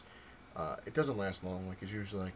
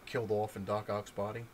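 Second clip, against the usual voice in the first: ordinary delivery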